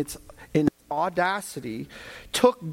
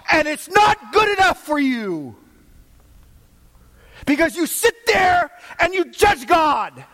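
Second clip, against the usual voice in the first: loud, high, driving sermon voice; preaching at full force; 10 semitones higher, 7 dB louder than usual. Acted. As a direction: preach it hard, rising to a shout.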